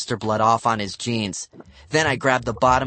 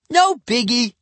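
sulky; 13 semitones higher, 5 dB louder than usual. A narrator's reading. Sulky kid repeating.

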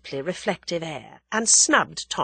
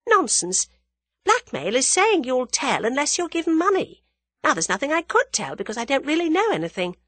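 higher, brisk female character; young woman character, dismissive; 9 semitones higher, 4 dB louder than usual. Acted. Young woman rejecting the blackmail worry, dismissive. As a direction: dismiss it, then argue the point emphatically.

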